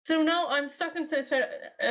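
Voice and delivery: usual speaking voice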